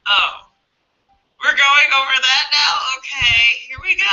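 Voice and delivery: surprised and amused as the shadow pops up; mock surprised shadow. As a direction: play the shadow catching you off guard, half-laughing.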